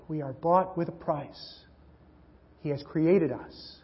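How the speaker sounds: usual speaking voice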